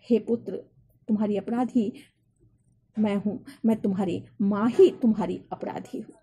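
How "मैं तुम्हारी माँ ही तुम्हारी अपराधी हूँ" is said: normal talking voice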